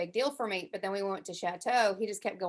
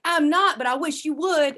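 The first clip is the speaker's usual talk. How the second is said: loud, high defiant comeback; defiant retort; 7 semitones higher, 9 dB louder than usual. Retold or imitated. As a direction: dare him right back, loud. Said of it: "I'm like".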